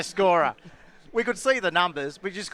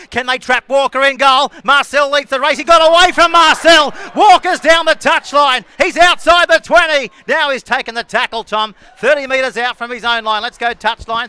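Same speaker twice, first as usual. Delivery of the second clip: loud, high running commentary; urgent live sports call, peaking high; excited play-by-play; 8 semitones higher, 13 dB louder than usual. Real, not acted. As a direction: build to a shout as the runner breaks away, then ease off. Live play-by-play of a breakaway run.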